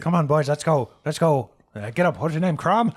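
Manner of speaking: loud, hurried urging; hurrying old man. Acted. Old man hurrying the boys along, loud urging. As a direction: herd them along, loud.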